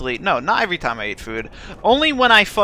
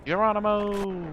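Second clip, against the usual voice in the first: mock battle cry; 5 semitones higher, 5 dB quieter than usual. Jumping in game.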